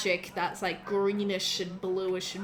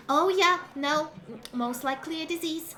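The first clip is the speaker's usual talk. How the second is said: high, cheerful healer voice; higher bubbly cleric diagnosing; bubbly halfling cleric; 6 semitones higher than usual. Acted. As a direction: reassure the patient brightly. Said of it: "looking after you and says".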